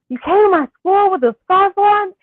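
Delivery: high, loud incredulous question; shocked disbelief. Retold or imitated. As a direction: replay the shock of seeing your mom there. Retold shock at mom's scarf.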